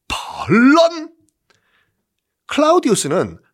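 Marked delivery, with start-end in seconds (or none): 0.1-1.1 훨씬 높게 길게 끌며 놀라기; 자다 깨어 소스라치듯. Acted. 황제가 놀라 깸. (자다 깬 황제, 높고 길게 놀란 외침)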